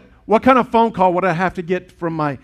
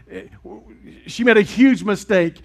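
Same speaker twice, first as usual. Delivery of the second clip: ordinary talk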